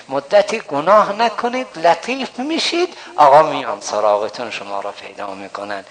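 ordinary talk

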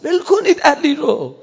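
high, loud, crying out; preacher voicing Imam Hussein crying out, loud and tearful; tearful shout. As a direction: shout through tears to let him go. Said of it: "داد زد امام حسین".

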